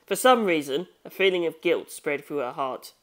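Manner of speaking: ordinary delivery, nothing marked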